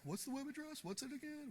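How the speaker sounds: high whiny mock of pestering students; mocking needy students. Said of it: "everybody's like".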